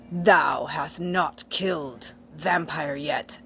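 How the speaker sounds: slow, put-on villain voice; hammed-up anger, every word drawn out; angry villain. A narrator's reading. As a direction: pronounce it slowly and heavily, like a menacing villain. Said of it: "he said angrily".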